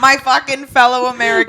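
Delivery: put-on a laughing, lightly Boston-accented president. Friends riff on a Boston president, laughing Boston accent. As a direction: play a brash Boston president at a podium.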